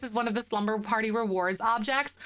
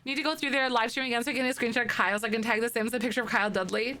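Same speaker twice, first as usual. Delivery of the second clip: ordinary delivery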